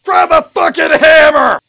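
very loud, slow, high yell; furious shout. Real, not acted. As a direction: bellow the order at him. Yelling at teammate during heist.

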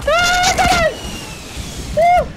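very high, loud scream; panicked screaming. Real, not acted. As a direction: scream as the bazooka hits. Hit by a bazooka.

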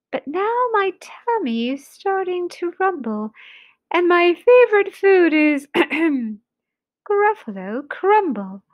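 lightly menacing little mouse. Acted. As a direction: play a small mouse talking big.

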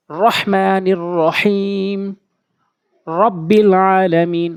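"রহমানির রহিম" slow, with full rounded trilled R; heavy R recitation. Shown as a demonstration. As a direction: recite slowly, drawing out vowels, thick R in "রব্বিল". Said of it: "র পুর করে উচ্চারণ করবেন".

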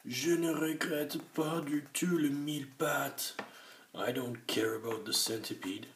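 high, loud blubbering voice; mock sobbing. A narrator's reading. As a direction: sob it out.

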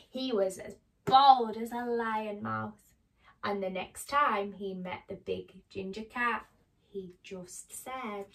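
animated picture-book reading; story narration to children. A narrator's reading. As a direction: read it to small children with careful, dramatic phrasing.

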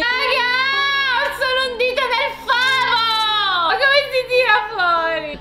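high-pitched, half-laughing wail; hammed-up helpless cry; panicked whining. Real, not acted. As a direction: squeal in comic distress over sticky fingers. Finger stuck in sticky honeycomb.

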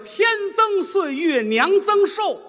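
slow, high, declaimed couplet; slow, high ceremonial recitation; reciting a couplet. Shown as a demonstration. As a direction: chant it like a festive couplet. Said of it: "上联儿是这个".

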